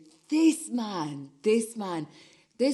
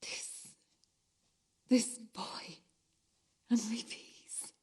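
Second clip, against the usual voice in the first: soft whispered; 6 dB quieter, slower than usual. Acted. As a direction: breathe the words. Whispered stage lines.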